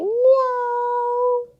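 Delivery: high squeaky meow; cat meow. Pretend. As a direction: mew like a little kitten, way up high. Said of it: "What does a cat say?".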